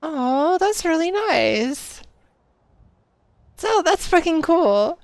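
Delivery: loud, slow thrilled exclamation; excited. Real, not acted. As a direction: draw it out with real delight. Thrilled by something in game.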